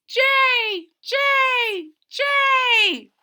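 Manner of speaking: high, loud jay call; bird cry. Acted. The jay bird crying out its name, shrill call.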